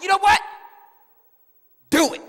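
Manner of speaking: loud, high outburst; fed-up dare. Acted. Child gives up pleading with mom, loud and fed up. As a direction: blurt the dare at full volume.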